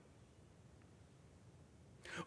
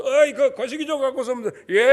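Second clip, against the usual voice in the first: Jeolla dialect; 3 semitones higher, 6 dB louder than usual. Retold or imitated. Preacher imitating Jeolla folk asking for something, Jeolla dialect. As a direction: call for the 거시기 in Jeolla speech. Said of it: "전라도 사람들은요".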